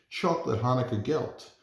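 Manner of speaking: ordinary delivery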